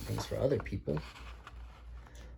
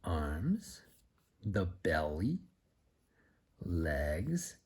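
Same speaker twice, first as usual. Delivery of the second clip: usual speaking voice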